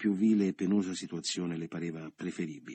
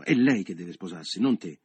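louder, insistent rebuke; angry; 7 dB louder than usual. A narrator's reading. Leo telling Michele off in front of Carla, angrily. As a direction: put the brother in his place.

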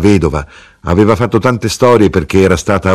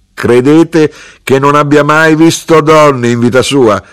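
high, loud rhetorical question; scoffing retort; 8 semitones higher, 5 dB louder than usual. A narrator's reading. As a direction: wave the objection away loudly. Mother scoffs at widow's protest.

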